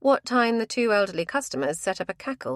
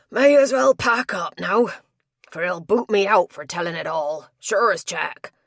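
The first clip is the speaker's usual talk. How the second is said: rough tapster voice; 7 dB louder than usual. Acted. Tapster resigned to losing job.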